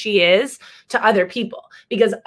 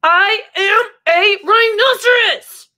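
higher, louder, slow and stubborn; insistent declaration; 11 semitones higher, 5 dB louder than usual. Acted. Lulu insists to doubters, high slow declaration. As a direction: plant every word, pitched high.

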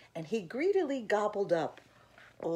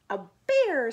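higher, very loud exclamation; loud disbelief; 7 semitones higher, 11 dB louder than usual. A narrator's reading. Mom answers Eddie's bear warning, incredulous. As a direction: burst out with the incredulous echo.

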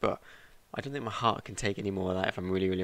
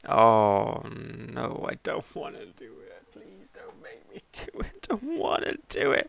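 scared horror game streamer; slower than usual. Real, not acted. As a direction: beg not to go on. Dreading the next part.

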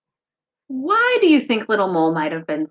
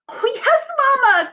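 put-on high, eager child mole voice; much higher, thrilled childlike mole; Little Mole crying out; 5 dB louder than usual. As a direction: cry out like an excited little mole. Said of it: "cried Little Mole".

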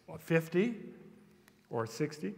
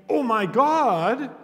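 heartfelt cry; 8 semitones higher, 8 dB louder, much slower than usual. Shown as a demonstration. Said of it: "perfectly natural ... to say".

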